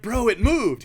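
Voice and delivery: high, loud, elated outburst; retold cry of delight; so happy. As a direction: blurt it out, thrilled and relieved. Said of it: "I'm so happy".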